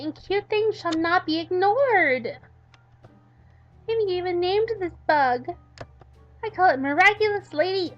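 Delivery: put-on a squealing girl defending her cute bug; high outraged Midori. Midori squealing at Yandere Dev over her bug, high and loud.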